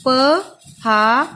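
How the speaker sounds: drawn-out sounding. Shown as a demonstration. Teacher sounding out उपहार slowly for pupils. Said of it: "वहां से आप लिख सकते हैं".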